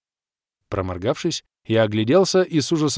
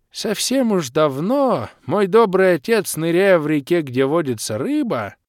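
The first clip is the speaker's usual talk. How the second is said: put-on slow, higher sing-song old man's rhyme; lightly voiced old man declaiming a poem; old man reciting verse; 6 semitones higher, 4 dB louder than usual. Old Makhrusha reciting his verse to the hero, slow and sing-song. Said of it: "Дед, давай без стихов".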